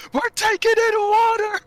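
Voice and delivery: high, loud mock alarm call; alarmed shout. Michael warning the crew, high alarmed shout. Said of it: "I just hear Michael go".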